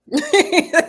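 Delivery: sudden high, loud burst of laughter; a shrill outburst of real laughter. Real, not acted. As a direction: crack up loudly.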